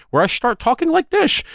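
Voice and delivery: high put-on Dutch accent. Shown as a demonstration. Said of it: "start affecting a Dutch accent".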